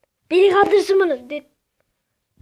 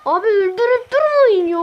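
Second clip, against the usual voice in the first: pleading shout; 5 semitones higher, 5 dB louder than usual. Real, not acted. Begging other players.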